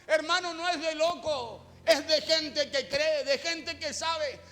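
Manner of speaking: impassioned preaching. Acted. Preacher retelling blind Bartimeo's plea to his congregation, impassioned preaching.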